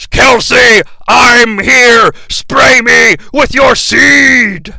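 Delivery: loud, slow heroic robot boom; hammed-up noble robot; Optimus Prime voice. Optimus volunteers to Kelsey in a booming heroic voice. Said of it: "Optimus volunteers".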